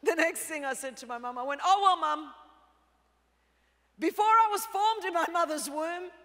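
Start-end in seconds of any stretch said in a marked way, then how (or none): 1.6-6.1 loud preacher's proclamation of scripture; full force preaching. Acted. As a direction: declaim it like from the pulpit.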